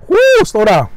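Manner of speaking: high, loud woo at himself; excited woo. Real, not acted. Eater telling himself to slow down, excited. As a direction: yelp at how good it tastes.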